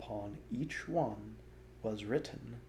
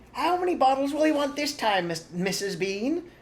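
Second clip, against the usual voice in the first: higher, louder call; shouting up; 9 dB louder than usual. A narrator's reading. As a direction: shout up the stairs. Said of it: "the woman shouted".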